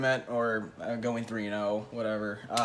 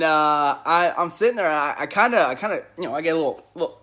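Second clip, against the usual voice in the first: ordinary talk, nothing marked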